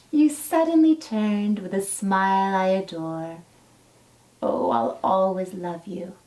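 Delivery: gentle, lilting storybook read-aloud; reading to children. Acted. Teacher reading a dinosaur story to her class, storybook lilt. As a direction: read it like a bedtime picture book. Reads a picture book to children.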